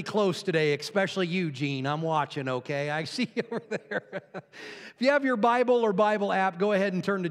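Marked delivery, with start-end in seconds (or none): 2.9-4.2 chuckling through the words; teasing laugh. Real, not acted. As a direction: tease someone in the audience, laughing. Teases a listener, laughing.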